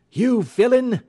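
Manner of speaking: loud, high accusation; angry confrontation. Acted. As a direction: confront the villain at full voice. Holmes confronts the villain.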